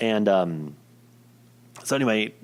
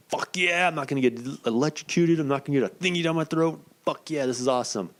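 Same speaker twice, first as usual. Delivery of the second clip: retold elation; 6 semitones higher than usual. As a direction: act out cheering at good news, voice lifted. Retold relief at avoiding the tests.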